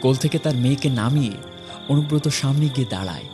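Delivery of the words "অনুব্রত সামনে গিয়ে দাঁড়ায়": ordinary talk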